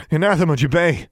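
high, loud incantation; shouted spell. A narrator's reading. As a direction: hurl the spell at a spirit. Shouts a banishing spell at spirit.

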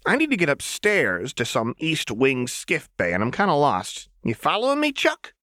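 put-on high, sing-song playful girl; high chirpy menace; manic Jinx voice. A narrator's reading. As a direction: tease the hostage brightly.

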